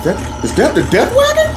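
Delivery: high, halting mock disbelief; astonished hope. Boy about to fight hears mom's car, acted high-pitched amazement. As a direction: stammer it upward, hardly daring to believe.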